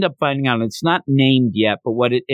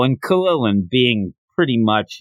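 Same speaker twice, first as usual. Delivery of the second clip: usual speaking voice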